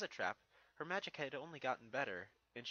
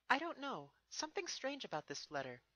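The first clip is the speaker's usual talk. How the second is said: higher, lightly voiced young mare; a thoughtful pony princess, pitched up; Twilight's voice; 12 semitones higher than usual. Twilight answers Spike, lightly voiced and higher.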